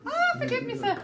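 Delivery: high-pitched Irish pleading demo; Irish accent. Shown as a demonstration. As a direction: beg forgiveness in an Irish accent. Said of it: "are you doing the Irish accent".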